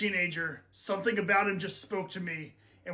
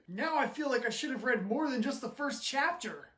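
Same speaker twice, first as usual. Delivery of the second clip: ordinary talk, nothing marked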